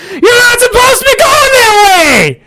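high, slow hollered warning at other drivers; retold yell. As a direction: shout it out the car window at them. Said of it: "I'm yelling out the window".

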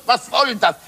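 ordinary delivery

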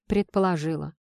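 plain reading voice

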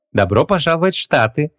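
loud greeting. A narrator's reading. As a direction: call out a welcome to a foreigner. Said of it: "громко воскликнула она".

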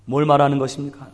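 ordinary talk

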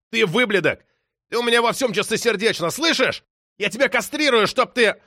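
high-pitched raging tirade; seething, overheated outburst; furious threat. A narrator's reading. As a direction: rant at the caller, voice shooting up. Artur shaking, threatens the caller.

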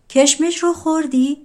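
cat upset its raisin was eaten, mildly aggrieved. Acted. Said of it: "گربه با ناراحتی گفت".

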